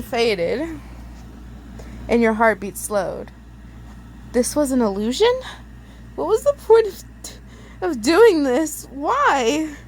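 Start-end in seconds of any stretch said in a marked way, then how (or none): 4.3-9.7 crying out. Acted. Girl crying out to Ben after the illusion, high desperate cry. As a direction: cry out why in disbelief. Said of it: "you cried".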